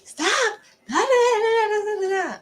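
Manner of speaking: high-pitched hammed-up scolding yell; mock yelling. Preacher acting out yelling at somebody, high mock yell. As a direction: imitate yourself yelling at somebody in blah-blah syllables. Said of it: "I will be yelling at somebody".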